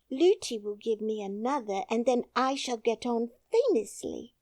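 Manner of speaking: lightly voiced small girl, polite and brave; a child trying to sound grown-up; little princess.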